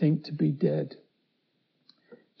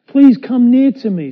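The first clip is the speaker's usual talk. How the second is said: calling them close; 7 semitones higher, 12 dB louder than usual. Acted. Joseph calls brothers closer.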